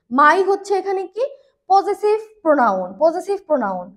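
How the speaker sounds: ordinary talk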